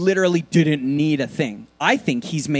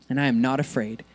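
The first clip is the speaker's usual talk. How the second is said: hushed statement of faith; quiet, sincere declaration; 6 semitones lower, 6 dB quieter than usual. Acted. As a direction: say it softly to yourself.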